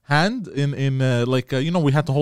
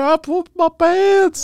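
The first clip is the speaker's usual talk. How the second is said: very high, drawn-out mock wail; imitated tearful yelling; crying yell; 4 dB louder, much slower than usual. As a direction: bawl it out like a miserable kid, hammed up. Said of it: "The whole time I was crying".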